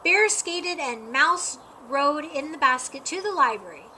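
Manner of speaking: ordinary delivery, nothing marked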